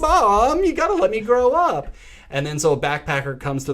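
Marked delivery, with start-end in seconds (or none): 0.0-1.9 much higher and louder, mock begging; exaggerated high teenage whine; whiny teen daughter. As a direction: ham up a teenager's plea to her mom.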